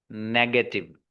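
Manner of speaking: ordinary talk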